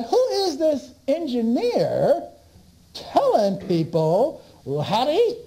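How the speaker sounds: high, slow mock outrage from the doctors; a hint of scandalized doctor; indignant doctors. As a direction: play the offended doctor, lightly. Doctors complaining about the engineer.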